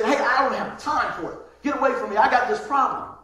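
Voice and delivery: dismissive brush-off. Pretend. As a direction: push the helper away, annoyed.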